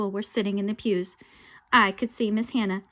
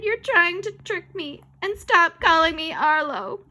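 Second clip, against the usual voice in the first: high, slow, emphatic complaint; indignant protest; 8 semitones higher, 6 dB louder, slower than usual. Acted. Boy accuses the ghost, indignant high protest. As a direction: whine it high and insistent.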